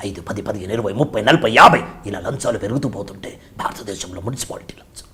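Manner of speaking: low, hushed film-father voice, swelling on the count; hushed, whispery rebuke that builds as the list grows; Kamal Haasan impression. Father answering his son about bribes, low whispery impression. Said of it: "ఇదే కమల్ హాసన్ గారికి వచ్చేసరికి".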